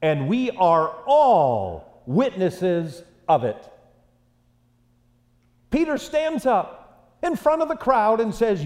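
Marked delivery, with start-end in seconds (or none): 0.0-3.7 slow declaration. Retold or imitated. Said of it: "what does he say to the crowd?".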